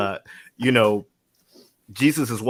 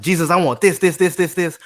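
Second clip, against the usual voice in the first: louder, higher demanding list; greedy asker; 7 semitones higher, 8 dB louder than usual. Retold or imitated. People praying, loudly demanding this and this from Jesus. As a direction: rattle off wants like a greedy child. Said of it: "We're like".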